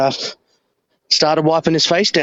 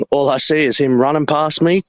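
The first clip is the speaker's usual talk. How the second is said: ordinary talk, nothing marked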